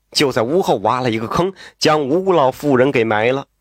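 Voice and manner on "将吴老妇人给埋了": usual speaking voice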